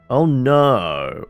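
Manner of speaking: ordinary delivery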